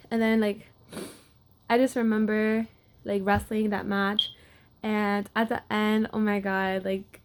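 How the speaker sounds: ordinary talk, nothing marked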